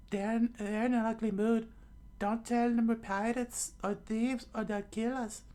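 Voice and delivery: ordinary delivery, nothing marked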